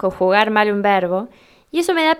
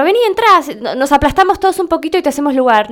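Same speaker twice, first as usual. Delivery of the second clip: eager coaxing invitation; 4 semitones higher, faster than usual. Acted. Friend urging someone to squeeze in, eager and hurried. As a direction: coax them in with a quick, raised voice.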